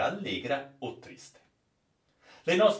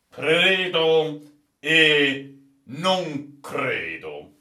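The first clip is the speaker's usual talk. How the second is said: put-on old sailor voice; 5 semitones higher, 8 dB louder, much slower than usual. Old sailor begins his tale.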